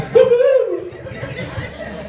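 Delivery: squealing high-pitched giggle; a giddy shriek of a laugh. Acted. Gossiping ladies squeal with delight, high giggle.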